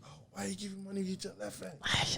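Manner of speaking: high, hushed imitation of a scolding; mock scolding official. Visa lady objecting to the left hand, hushed mock scolding.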